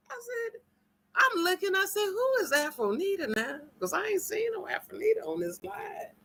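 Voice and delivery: high hushed mock confusion; high soft retelling. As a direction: replay the question high and hushed. Retelling her own question.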